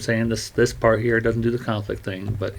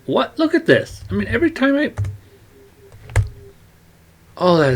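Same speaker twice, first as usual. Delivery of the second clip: surprised; 12 semitones higher than usual. Real, not acted. Software suddenly acts weird.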